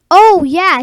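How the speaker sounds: ordinary talk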